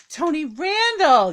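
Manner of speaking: high, loud, stretched-out name; cheering announcement. Real, not acted. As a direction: hail the star with a drawn-out cry. Cheering the guest who just performed.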